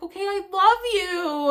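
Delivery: high, loud, effusive call; retold gushing affection. Woman to the helper before tomorrow's hug, gushing loudly.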